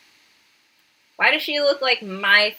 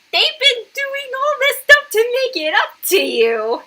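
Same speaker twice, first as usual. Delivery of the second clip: high-pitched exasperated argument; 14 semitones higher than usual. Real, not acted. Defending the parents to the girl, high exasperated pleading. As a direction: argue it up high in disbelief.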